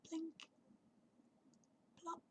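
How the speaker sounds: very soft, high little blips; tiny raindrop sounds. A narrator's reading. Storyteller imitating the first raindrops, very soft and sparse. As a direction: drop each word lightly, like single raindrops.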